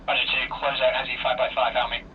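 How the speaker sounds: usual speaking voice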